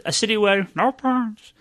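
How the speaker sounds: slow, high silly voice; goofy voice. Pretend. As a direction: put on a goofy cartoon voice. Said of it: "What the fuck was that?".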